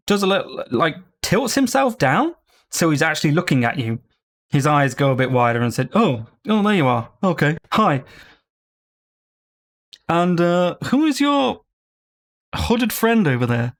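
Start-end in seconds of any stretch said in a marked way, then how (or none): 5.9-13.8 lightly posh talking sword, a bit higher; a curious pompous blade greeting them; hint of an aristocratic sword; bougie sword. GM voices the sword.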